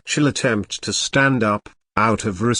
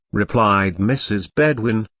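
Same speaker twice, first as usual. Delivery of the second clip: ordinary delivery, nothing marked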